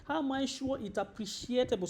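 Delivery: ordinary delivery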